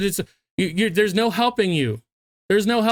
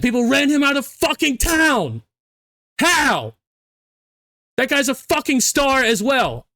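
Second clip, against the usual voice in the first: angry rant; 5 semitones higher than usual. Real, not acted.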